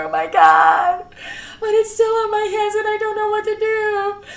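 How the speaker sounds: builds from anxious to high, loud and frantic; breathless guilty panic growing to a wail; rising panic. Retold or imitated. Frantic retelling of her accident.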